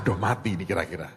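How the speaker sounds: low, soft, fast muttered thought; inner thought. Retold or imitated. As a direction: say it under your breath, quick and low. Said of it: "Otak saya berkata". (gumam batin yang cepat dan pelan)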